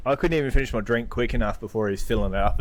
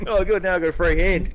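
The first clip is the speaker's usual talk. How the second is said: gleeful; 8 semitones higher, 4 dB louder than usual. Retold or imitated. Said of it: "retold fight thought".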